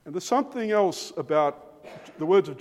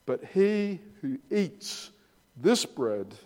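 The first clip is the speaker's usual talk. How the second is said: slow solemn emphasis; slower than usual. Acted. Slow scripture climax.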